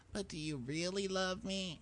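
high, soft little voice; mock needy. She questions him about love, mock needy. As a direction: imitate a clingy girlfriend, high and quiet. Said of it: "when she says anything to him that's like".

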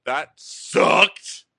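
imitated sore-loser yell; mock outburst. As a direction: blurt it out, hitting the last word hard. Acting the man's parting outburst.

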